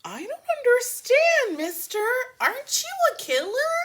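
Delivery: high, innocent, mock-puzzled kid; high childlike faux-innocent question; sassy little girl. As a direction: play dumb like a cheeky kid. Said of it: "see her being like".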